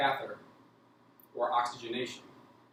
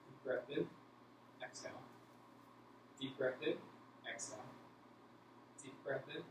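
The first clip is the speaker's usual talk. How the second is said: gentle, soft breath-by-breath prompts; soft breathing coaching; 12 dB quieter than usual. Acted. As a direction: coax each breath calmly and quietly. Nurse coaching patient's breathing.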